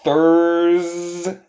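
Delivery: "Thurs-" with its final s held long; drawn-out S sound. Shown as a demonstration. Teacher showing students the S in "Thurs-", drawn out. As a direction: hold the s at the end of "Thurs-". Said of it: "S como oveja".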